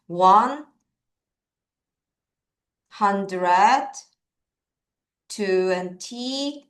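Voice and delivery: slow, chunk by chunk with long pauses; slow step-by-step number reading; slow demo reading. Shown as a demonstration. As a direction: lay out each part separately for the kids. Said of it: "요렇게 읽는 거예요".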